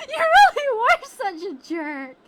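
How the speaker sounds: very loud, high laugh-cry; overwhelmed fan laughing while crying; laughing through tears. Real, not acted. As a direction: laugh and sob at once.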